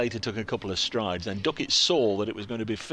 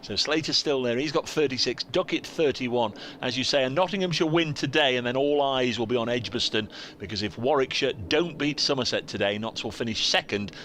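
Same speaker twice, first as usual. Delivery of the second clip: louder, raised sports-bulletin delivery; radio score update; 3 semitones higher, 4 dB louder than usual. Real, not acted. Commentator updating Radio Nottingham listeners on the final day, projected bulletin read. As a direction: project a crisp summary of play for station listeners.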